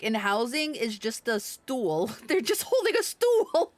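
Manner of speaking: ordinary talk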